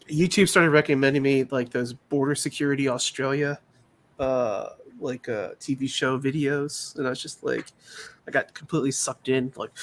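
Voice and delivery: ordinary delivery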